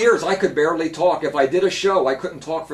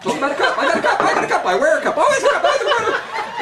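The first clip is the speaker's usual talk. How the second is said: high, manic riffing voice; rapid-fire goofy improv; Robin Williams riff; 3 dB louder, faster than usual. Retold or imitated. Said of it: "Robin Williams, one night, it could be on a cup".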